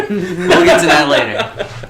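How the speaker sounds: high laughing reply. Real, not acted. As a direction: giggle it out slowly. Laughing off the question.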